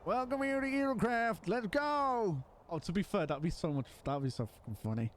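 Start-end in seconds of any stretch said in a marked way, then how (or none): 0.0-2.4 high comic mascot voice; Meta's voice. Retold or imitated. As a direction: squeak it like a cartoon mascot. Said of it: "pulling out Meta's voice".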